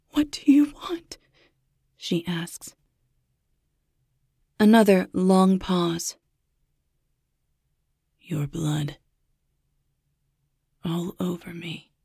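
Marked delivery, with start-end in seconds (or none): 0.1-1.2 terrified whisper. Acted. Babysitter asks caller, terrified. 8.2-11.9 soft, menacing whisper; sinister whisper. Acted. Stalker's chilling answer.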